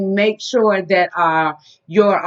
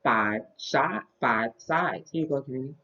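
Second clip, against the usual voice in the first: put-on reading to a toddler; 5 semitones lower, 8 dB quieter, slower than usual. Teaching toddler shapes.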